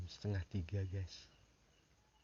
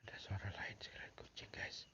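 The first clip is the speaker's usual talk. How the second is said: breathless hushed whisper; whispering on the phone; 11 dB quieter than usual. Real, not acted. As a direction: whisper so nobody hears. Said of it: "Aku nggak bisa ngomong keras-keras".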